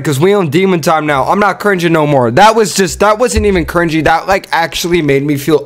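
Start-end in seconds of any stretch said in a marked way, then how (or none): none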